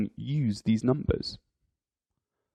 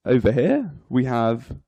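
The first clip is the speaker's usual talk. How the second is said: normal talking voice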